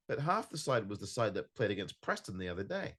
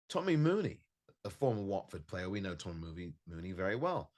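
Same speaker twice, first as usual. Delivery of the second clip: normal talking voice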